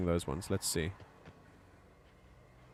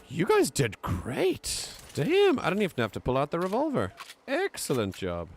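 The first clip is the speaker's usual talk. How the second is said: excited praise; 8 semitones higher, 4 dB louder than usual. Real, not acted. Praising companions after fight.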